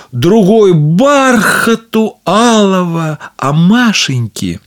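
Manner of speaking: ordinary delivery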